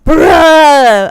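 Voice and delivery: loud high bruh; mock frustrated shout. Imitating him in traffic, loud frustrated bruh. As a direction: yell bruh at the slow car. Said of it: "He's like".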